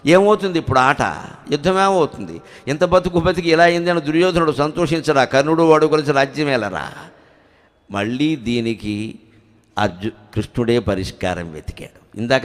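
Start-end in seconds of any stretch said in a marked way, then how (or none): none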